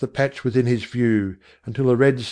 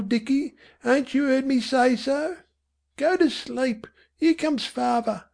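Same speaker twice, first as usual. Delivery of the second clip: high, hushed Cockney mother; mother's voice; 13 semitones higher than usual. A narrator's reading. Mother hushing Dicky to sleep, high and hushed. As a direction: soothe the boy in a tired mother's voice.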